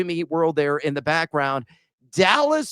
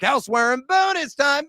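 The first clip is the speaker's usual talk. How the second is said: high, raised announcement of the segment; announcer call; 8 semitones higher than usual. Acted. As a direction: bring in bonus time with energy.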